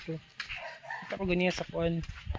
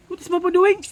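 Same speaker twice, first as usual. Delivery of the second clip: called out loud and high, rising at the end; loud, higher question; 12 semitones higher, 11 dB louder, faster than usual. Real, not acted. Mother repeats question to child. (kusog ug taas nga pangutana)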